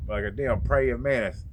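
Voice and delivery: usual speaking voice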